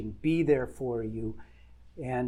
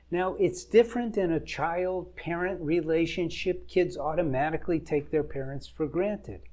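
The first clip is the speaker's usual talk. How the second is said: ordinary talk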